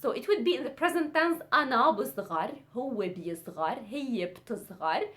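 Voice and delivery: fast conjugation drill; quick-fire teacher recital; really fast. Shown as a demonstration. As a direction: rattle through the forms. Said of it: "conjugate it also really fast".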